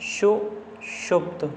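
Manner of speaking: सुषुप्त whispered slowly, su-shupt, falling; slow whispered demo. Shown as a demonstration. Teacher to students showing the word, slow whisper. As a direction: breathe सुषुप्त out slowly, syllable by syllable. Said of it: "ऐसे नहीं लिखा जाता".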